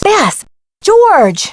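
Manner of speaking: high-pitched call to friends. Acted. Nancy calling for friends Beth and George, calling out. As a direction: call their names out, pitched well up.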